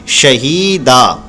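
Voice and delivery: शहीदा slowly, with a long ही; stretched hee. Shown as a demonstration. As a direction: draw out the ही in शहीदा. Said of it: "इसलिए इसको खींचेंगे".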